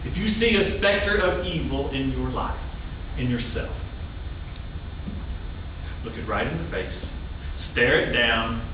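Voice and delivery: low-pitched, spooky warning; ominous monologue. Acted. Warning a theater audience of evil hiding inside, ominously.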